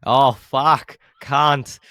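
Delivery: sudden loud swear; frustrated cursing. Retold or imitated. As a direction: blurt it out in frustration. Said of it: "It's going like".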